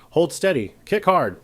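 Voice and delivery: high urgent shouted orders; sharp shout. A narrator's reading. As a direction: snap the orders out loud. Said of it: "voice sharp".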